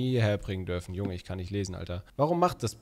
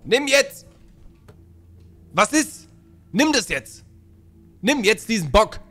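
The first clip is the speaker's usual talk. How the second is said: high, loud insistent commands; exasperated high-pitched ordering; impatient shouting; 9 semitones higher, 8 dB louder than usual. Real, not acted. Pressing a toy on the baby.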